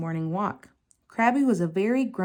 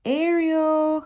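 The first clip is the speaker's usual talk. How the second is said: higher and louder, long call; 9 semitones higher, 5 dB louder than usual. A narrator's reading. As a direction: call her name across the sea. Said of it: "Flounder called".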